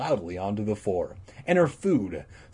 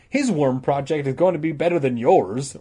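teasing; 4 semitones higher, 5 dB louder than usual. Acted. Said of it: "Regina teased him".

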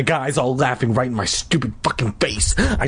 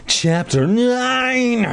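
announcing chapter; 10 semitones higher, much slower than usual. Real, not acted. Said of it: "announcing the chapter".

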